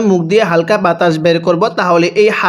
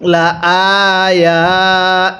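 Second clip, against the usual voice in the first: লা আয়া slow, the stretch put on আয়া not on লা; tajweed recitation; much slower than usual. Shown as a demonstration. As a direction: lengthen আয়া, keep লা short. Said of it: "তাহলে কিভাবে পড়ব?". (লা ছোট রেখে আয়া টেনে পড়া)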